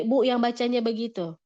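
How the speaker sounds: normal talking voice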